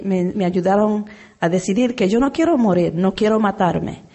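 ordinary talk, nothing marked

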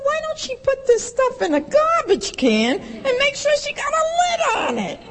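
very high, laughing disbelief; incredulous. Retold or imitated. Newcomer at AA laughing at the woman's openness. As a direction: squeal it in amused disbelief. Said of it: "And I said".

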